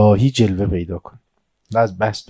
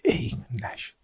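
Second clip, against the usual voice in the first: whispered aside; 11 dB quieter, slower than usual. Real, not acted. Aside after the failed entry.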